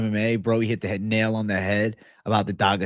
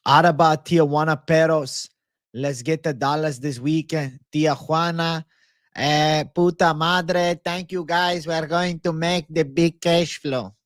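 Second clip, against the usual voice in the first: higher-pitched put-on Mexican accent; mock Mexican accent; 4 semitones higher than usual. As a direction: hype them up with a playful Mexican accent. Hyping Tijuana in put-on Mexican accent.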